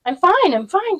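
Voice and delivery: high, bright repeated reassurance; excited Lena. A narrator's reading. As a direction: brush off worry happily.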